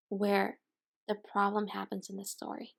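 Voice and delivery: ordinary delivery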